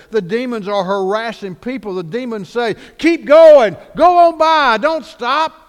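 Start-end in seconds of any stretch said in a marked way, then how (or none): 3.0-5.5 high, forceful shout; very loud, urgent command; demons' shouted warning. Acted. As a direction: shout them on past the house.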